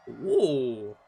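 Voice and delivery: normal talking voice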